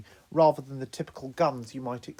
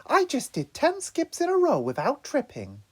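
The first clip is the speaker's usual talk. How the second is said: proud; 13 semitones higher, 3 dB louder than usual. Acted. Donatello boasting proudly.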